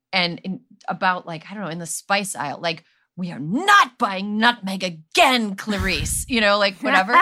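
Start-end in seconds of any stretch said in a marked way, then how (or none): 3.2-6.3 slow, hammed-up mid-Atlantic accent, a bickering couple; mock mid-Atlantic accent. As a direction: play a fake mid-Atlantic spouse in a staged grocery spat, slowly. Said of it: "fake mid-Atlantic accents".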